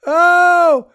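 high, loud, drawn-out "oh" of delight; overjoyed stranger's booming greeting, imitated; happy holler. Retelling a stranger greeting him on the sidewalk, happy holler. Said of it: "he so happily".

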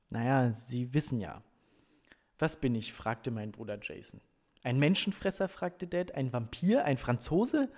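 animated audiobook reading with lively dialogue; story narration. A narrator's reading. Reader performs coach's visit to the family, story narration. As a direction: read out the scene with emphatic dialogue. Reads novel passage aloud.

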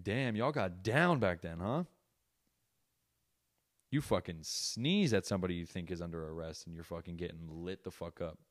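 ordinary talk, nothing marked

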